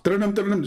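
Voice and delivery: ringtone sung out with the mouth; mock phone ring; imitated phone ringing. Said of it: "See how fast the ringing?".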